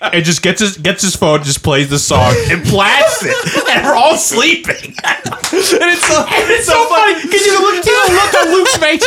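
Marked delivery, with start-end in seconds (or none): 1.4-6.2 laughing through the words; giggling while telling it. Real, not acted. 6.2-9.0 high, laughing while talking; cracking up mid-sentence. Real, not acted. Laughing through the story.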